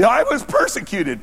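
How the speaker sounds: high, drawn-out mock grievance; mock persecution complaint. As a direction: overplay the victim, slow and high. Mocking those who claim persecution.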